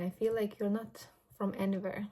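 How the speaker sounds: usual speaking voice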